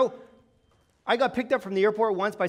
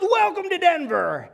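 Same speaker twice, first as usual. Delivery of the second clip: higher, louder, slow hearty welcome; friendly driver welcome; 8 semitones higher, 5 dB louder, slower than usual. Retold or imitated. The driver welcoming the family to Denver, higher, slow and hearty. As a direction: roll out a warm big welcome.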